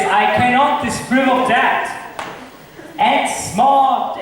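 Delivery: ordinary delivery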